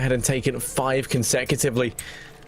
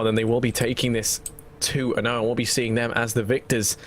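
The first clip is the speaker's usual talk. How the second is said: ordinary delivery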